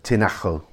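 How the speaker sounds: Tinajo as tee-NA-kho; J as H sound. Shown as a demonstration. Guide repeating the tricky town name, J as throaty H. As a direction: say Tinajo with a breath-rasped J. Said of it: "almost an H sound".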